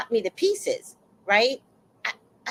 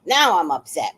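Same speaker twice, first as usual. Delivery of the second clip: ordinary delivery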